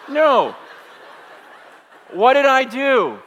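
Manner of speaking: loud, high shocked gasp from the crowd; mock gasp. Congregation aghast at the pastor, loud shocked protest. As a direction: play the congregation's horrified no. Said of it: "You guys are like".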